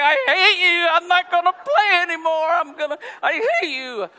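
put-on high, loud sobbing kid voice; crying brother. Brother crying at the tennis court, high loud sobbing rage. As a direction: wail through tears like an angry little brother.

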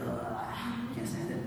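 high, soft, whiny groan of discomfort; mock complaint about the water in her ears; whiny disgust. Retelling lying in bed crying, a whiny disgusted groan.

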